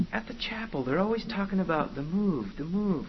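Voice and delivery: feed the line to a trainee, quietly mocking; coaching demon boss. Boss tells Junior what to whisper, coaching. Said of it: "says to Junior".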